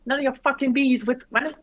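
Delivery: put-on Australian accent, cheeky comeback. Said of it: "you could do an Aussie accent".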